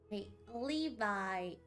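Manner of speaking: usual speaking voice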